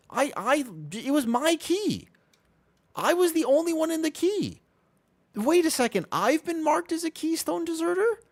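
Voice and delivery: real high-pitched disbelief at the game; squeaky outrage. Real, not acted. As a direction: shriek at the unfair game flag in disbelief. Outraged at being flagged deserter.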